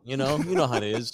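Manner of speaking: ordinary delivery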